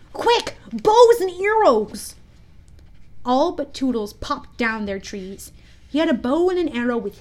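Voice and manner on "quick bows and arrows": high, loud, drawn-out order; urgent call. A narrator's reading. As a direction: call the others to arms. Boys rush for their weapons.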